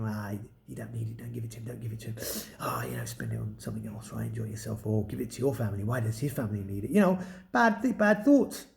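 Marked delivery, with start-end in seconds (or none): 0.0-6.9 low tempting whisper, breathy and sly; devil whispering. Pretend. Voicing a devil tempting the friend, in a low whisper. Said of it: "a devil whispering".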